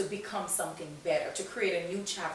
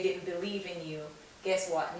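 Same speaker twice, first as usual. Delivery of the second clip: ordinary talk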